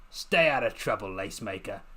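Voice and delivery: gentle gruff warning. A narrator's reading. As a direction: soften the warning. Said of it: "he said in a softer voice".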